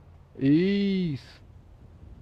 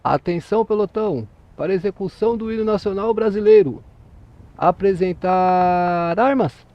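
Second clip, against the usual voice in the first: military drill command; 2 semitones higher, 4 dB louder than usual. Shown as a demonstration. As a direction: call it like a drill sergeant, stretching the final word. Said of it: "fica em posição de descansar".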